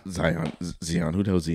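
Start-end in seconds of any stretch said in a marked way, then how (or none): 0.1-0.5 Zion said as ZYE-on; alternative pronunciation. Shown as a demonstration. Unsure shopper trying out the sneaker name, Zion as ZYE-on. Tries both ways of saying the name. 0.8-1.1 Zion said as ZEE-on; soft ZEE-on for Zion; alternative pronunciation. Shown as a demonstration. Unsure shopper trying out the sneaker name, Zion as ZEE-on. Tries both ways of saying the name.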